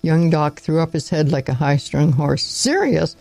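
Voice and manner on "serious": sharply higher, disbelieving; incredulous echo. A narrator's reading. Doctor scoffing at grandfather's word, high and disbelieving. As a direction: throw the word back in disbelief.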